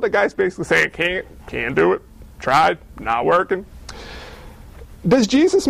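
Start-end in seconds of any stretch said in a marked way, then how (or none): 0.9-3.6 resigned. Acted. Invalid giving up on being healed.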